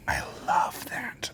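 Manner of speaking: slow whispered delight; whispering. Real, not acted. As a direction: breathe it softly. Whispered reaction to a guess.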